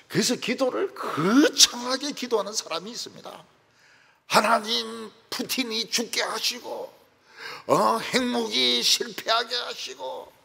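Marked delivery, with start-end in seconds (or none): 4.3-10.3 louder, higher mock-grand petition; hammed-up lofty prayer, slowing at the end; grandiose prayer. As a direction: pray like a pompous intercessor. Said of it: "거창하게 이렇게 기도하는데".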